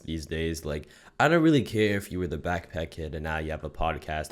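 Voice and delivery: lightly voiced low, lazy host intro; a hint of a low-voiced roast host; low mock podcaster persona. Opens vlog in a held persona.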